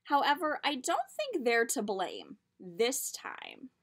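lively storybook read-aloud; reading to children. A narrator's reading. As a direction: tell it like a picture book. Reads picture book aloud.

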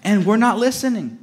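usual speaking voice

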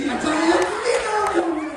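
ordinary delivery

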